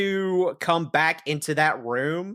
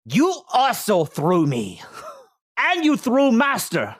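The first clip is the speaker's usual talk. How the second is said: muffled, cheeks packed with food; mouth full; 7 semitones higher than usual. Acted. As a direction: whine through a mouthful of tacos. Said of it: "he's got his cheeks packed, so it's kinda muffled".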